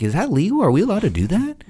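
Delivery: high, squeaky, unsure voice; nervous high disbelief; incredulous question. Retold or imitated. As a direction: ask it as if you can't believe it's allowed. Asking the boss in disbelief.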